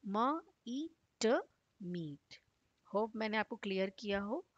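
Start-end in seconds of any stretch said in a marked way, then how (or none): 0.1-2.4 म, ई, ट, then मीट with a long ई like the letter name; sounding out. Shown as a demonstration. Teacher sounds out मीट for a child, letter by letter. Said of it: "हमारा normal letter name ई".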